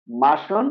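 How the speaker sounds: "মাসন" as ma-shon, the r silent; correct, silent R. Shown as a demonstration. Teacher corrects the word, "মাসন" as ma-shon. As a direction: drop the r in "মাসন", end on shon. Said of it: "recap".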